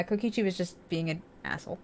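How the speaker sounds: ordinary delivery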